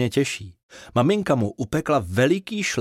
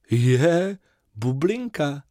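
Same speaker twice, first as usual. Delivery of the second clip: delighted; 4 semitones higher, much slower than usual. Acted. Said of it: "zaradoval se Fífa".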